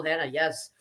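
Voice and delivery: ordinary talk, nothing marked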